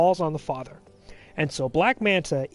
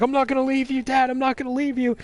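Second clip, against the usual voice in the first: mock crying plea; 7 semitones higher, faster than usual. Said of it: "starting to cry and everything".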